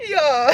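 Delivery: shrieking high laughter; high squeal laugh. Real, not acted. Reader squealing with laughter at the lyric. Cracking up after the quote.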